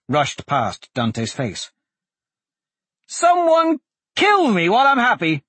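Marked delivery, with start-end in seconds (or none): 3.1-5.4 high, loud joyful shout. Acted. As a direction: yell it out in wild relief. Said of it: "Blaze shouted".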